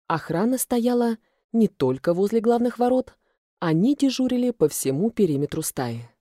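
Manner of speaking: usual speaking voice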